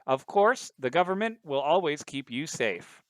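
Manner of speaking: high, loud anchor sign-off, slowing; news anchor voice. Acted. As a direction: close the broadcast like an anchor. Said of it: "anchor's like".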